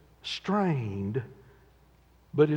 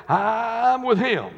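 slow, loud, drawn out; slow loud boast; slow proud boast; 7 semitones higher, 5 dB louder, much slower than usual. Retold or imitated. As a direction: stretch every word with pride. Peter's boast, slowed.

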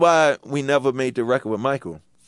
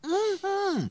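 high, soft hum through a mouthful; Michael answers with his cheeks full of food; 10 dB quieter than usual. Retold or imitated. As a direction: hum like a kid chewing happily. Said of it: "kid smile with jaws full of food going".

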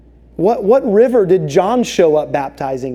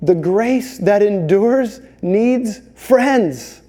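slow emphasis; much slower than usual. Acted. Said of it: "slow key line".

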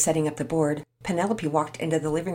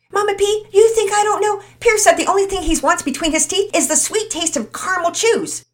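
put-on much higher and louder, cheeky boy; joking exuberantly; 10 dB louder than usual. Todd teasing Mama Peters about Pierce, loud and cheeky. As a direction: tease with a grin.

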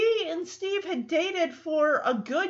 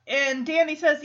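ordinary delivery

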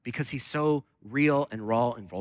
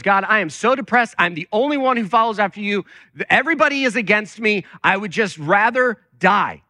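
raised, emphatic retelling of a desperate prayer; acted despairing outburst; 5 semitones higher, 5 dB louder than usual. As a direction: cry out Elijah's complaint to God, slowing at the end. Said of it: "he gets into the desert and he goes".

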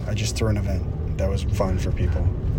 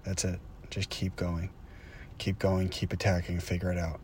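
softer, fading self-encouragement; quiet; 3 semitones lower, 13 dB quieter than usual. Real, not acted. Urging himself on to keep going, quietly. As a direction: murmur it to yourself, almost inward.

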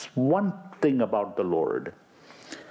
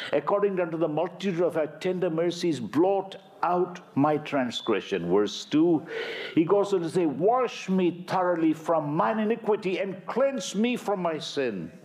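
higher, quickened pleading; impassioned scripture voice; fervent psalm reading; 6 semitones higher than usual. Acted. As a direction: press the plea upward. Preacher reads psalm aloud.

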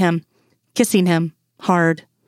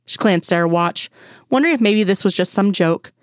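ordinary delivery, nothing marked

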